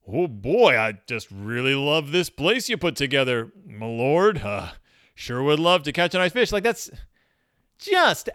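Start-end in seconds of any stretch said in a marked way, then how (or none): 0.1-6.5 sucking up. Retold or imitated. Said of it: "you're just patronizing God".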